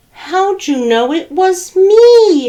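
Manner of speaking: high, loud mock howl; howling turkey. A narrator's reading. Turkey caught by Rooster, high loud howl. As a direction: howl it in frustration. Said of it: "howled Turkey".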